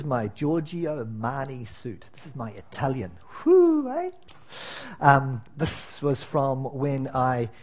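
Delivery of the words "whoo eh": high, bright show-off whoop; playful whoop. Acted. Pastor showing the congregation his suit, in a high excited whoop. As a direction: crow over the fancy suit.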